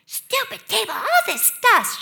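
put-on high, cross little-kid voice; three-year-old daughter. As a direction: imitate a toddler cursing the furniture. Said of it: "she was going".

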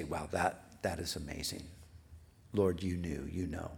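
soft, low murmur of wonder; quiet awe. Acted. Believer looking back and praising the Lord, quiet awe. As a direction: let the voice sink low and hushed.

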